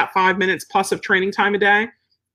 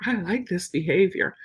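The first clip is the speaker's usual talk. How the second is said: soft, quiet praise; calm praise; 6 dB quieter, slower than usual. Retold or imitated. Trainer praising her dog lying calmly, soft and quiet. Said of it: "I might just stroke them".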